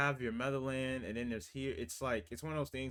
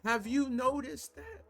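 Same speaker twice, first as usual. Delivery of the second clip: impassioned; 10 semitones higher, 4 dB louder than usual. Real, not acted. Impassioned rant about unity.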